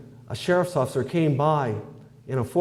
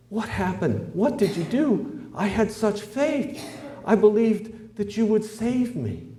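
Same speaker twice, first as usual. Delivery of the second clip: higher, slow and pleading; bewildered plea; 8 semitones higher, slower than usual. Acted. Drowned man pleading with his guardian angel, high and slow. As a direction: ask it in hurt disbelief, drawn out.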